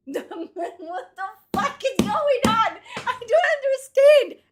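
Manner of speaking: laughing disbelief. Real, not acted.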